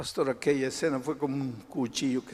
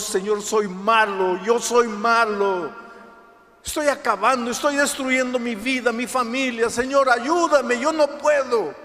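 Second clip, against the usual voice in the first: clamor desesperado; 10 semitones higher, 8 dB louder than usual. Acted. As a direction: beg God at full voice, desperate. Acts sinner crying out to God. (súplica desesperada a gritos)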